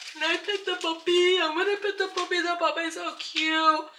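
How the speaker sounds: high, gushing plea to pet the puppy; excited pleading. Retold or imitated. Asking the lady to pet the puppy, high excited begging. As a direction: beg like a kid in a candy store. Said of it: "to say hello and ask if I can".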